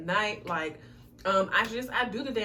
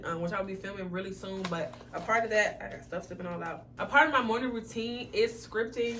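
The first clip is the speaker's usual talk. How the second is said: usual speaking voice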